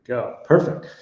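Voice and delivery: ordinary delivery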